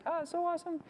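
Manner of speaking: sarcastic, high-pitched so awesome; mock delight. Real, not acted. Sarcastic joy at a computer glitch.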